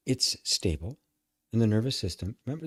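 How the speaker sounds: ordinary delivery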